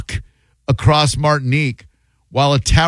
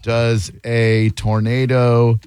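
word-by-word slow question; slow, deliberate; much slower than usual. Real, not acted. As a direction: spell it out slowly, word by word. Reading out a search query.

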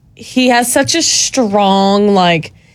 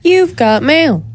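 slow, higher AOL announcer imitation; soundbite voice; 5 semitones higher, slower than usual. Shown as a demonstration. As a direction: deliver it like the email alert. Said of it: "Say it again or give it the soundbite".

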